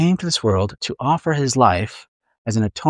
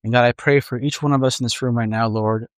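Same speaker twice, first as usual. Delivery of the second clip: unhurried prayer with long pauses; a pastor's gentle closing prayer. Acted. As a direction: pray it aloud for the congregation.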